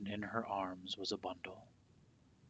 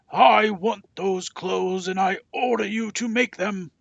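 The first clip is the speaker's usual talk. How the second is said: angry king; 12 semitones higher, 19 dB louder than usual. A narrator's reading. Scowling king demands clothes.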